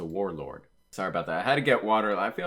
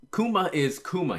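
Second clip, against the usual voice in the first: excited; 9 semitones higher, 5 dB louder than usual. Real, not acted. Emphatic fan praise.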